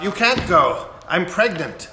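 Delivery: retold blurted confession; blurting out. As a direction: burst out with it before he leaves. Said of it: "Helga blurts out".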